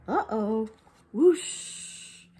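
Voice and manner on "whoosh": whoosh sound. Acted. Balloon whooshes off.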